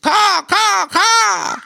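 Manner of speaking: loud, very high crow caws; crow cawing. A narrator's reading. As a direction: squawk back like a crow. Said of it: "said the crow".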